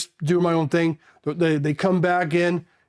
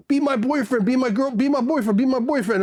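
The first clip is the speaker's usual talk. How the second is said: higher, repeated pleading call, lightly imitated; mimicked street callers; 7 semitones higher than usual. Women calling to Marines on a Thai street, higher light mimicry. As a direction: sing it out as a repeated plea.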